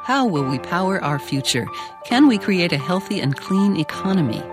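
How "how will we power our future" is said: usual speaking voice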